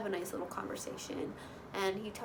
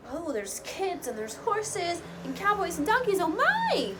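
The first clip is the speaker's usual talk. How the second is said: mock excitement; 7 semitones higher, 4 dB louder than usual. Gushing over carnival date.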